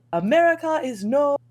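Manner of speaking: high, loud, slow mock-stranger voice; drawn-out, high-pitched impersonation; imitated stranger. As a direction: proclaim it slowly as the man you're mimicking. Mimics man going on about America.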